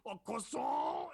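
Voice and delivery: loud, high, drawn-out fake French; obnoxious mock French yell, stretched out; screamed French accent. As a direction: scream it slowly in terrible French. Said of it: "I just scream out".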